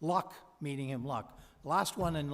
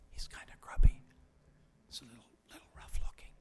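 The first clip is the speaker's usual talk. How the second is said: hushed, confiding whisper, a little fast; whispered aside; 3 semitones lower, faster than usual. Real, not acted. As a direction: whisper it like a secret. Confiding aside about stranger.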